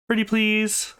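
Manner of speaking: high, drawn-out wheedle; cutesy high-pitched coaxing; pleading. Acted. Coaxing someone for a massage.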